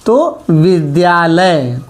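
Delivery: विद्यालय said slowly, the long या stretched out; teacher modelling how विद्यालय is pronounced; careful pronunciation. Shown as a demonstration. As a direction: sound out विद्यालय syllable by syllable, slow. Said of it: "इसका उच्चारण कैसे होगा?".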